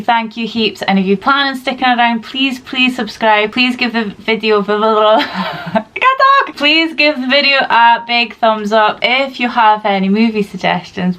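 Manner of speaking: normal talking voice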